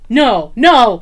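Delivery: loud, laughing no-no; shocked denial. Retold or imitated. Friend on speakerphone hearing about the hidden figure, shocked and laughing. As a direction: burst out in shocked disbelief.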